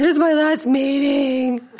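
high, slow, hammed-up tearful whine; mock tearful wail. Retelling to her daughter, a high, slow mock wail. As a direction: stretch it out like a mock sob. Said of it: "I said".

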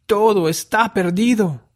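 very high, loud wail of despair; despairing outcry. A narrator's reading. José clenching his fists over the lost manuscript, despairing outcry. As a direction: cry out high and loud, all hope gone.

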